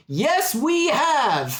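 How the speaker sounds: high, drawn-out chanted reply; crowd chorus. Acted. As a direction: answer like a whole congregation at once. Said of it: "a chorus of fifty or so people say".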